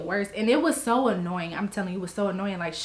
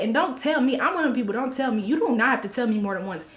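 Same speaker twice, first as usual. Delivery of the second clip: normal talking voice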